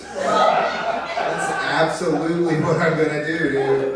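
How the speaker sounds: high, loud, drawn-out enthusiasm; gleeful declaration. Retold or imitated. Comic defying his coworker's warning, loud gleeful declaration. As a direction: announce it loudly and shamelessly.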